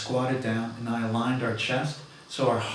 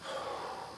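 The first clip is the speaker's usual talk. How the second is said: deep exhale; 11 dB quieter than usual. Real, not acted. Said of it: "continuing to breathe".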